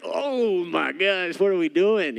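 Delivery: hammed-up alarm, drawn out; panicked. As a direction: play the nervous wreck signing the contract. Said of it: "you're like".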